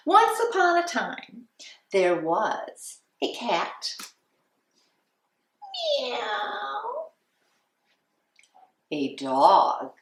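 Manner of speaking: lilting, sing-song storytime voice; reading to children. Acted. Teacher reading The Little Red Hen to class, storytime voice. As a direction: read it like a kindergarten teacher.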